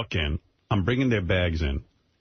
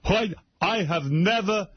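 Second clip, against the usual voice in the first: higher, slower mock indignant father; 9 semitones higher, slower than usual. Daughter imitating her angry father, higher and slow. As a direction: huff like an offended old man. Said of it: "He was like".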